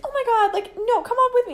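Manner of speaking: very high, fussing adult voice; store lady. Retold or imitated. Store lady leading lost girls away, high concerned voice. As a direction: take pity on lost kids, high-pitched. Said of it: "the lady's like".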